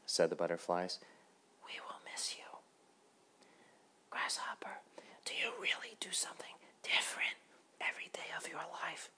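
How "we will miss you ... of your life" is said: hushed, breathy little butterfly voice; whispering butterfly. Acted.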